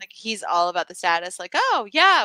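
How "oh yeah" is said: higher, louder show of approval; mock eagerness. Imitating dad who's all about the status, mock eager. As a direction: jump on it, playfully keen.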